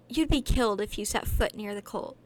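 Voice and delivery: threatening retort. Acted.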